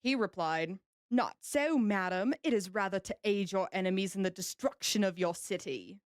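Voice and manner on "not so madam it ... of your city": British admiral's retort, put on; British accent. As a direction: answer back in a stagey British accent. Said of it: "Beautiful British accent".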